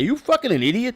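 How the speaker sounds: high, loud mock yell of the other manager; reenacting the rival manager snapping back; retold angry shout. Manager yelling at the clubhouse guy, high and loud.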